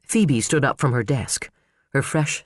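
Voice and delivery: ordinary delivery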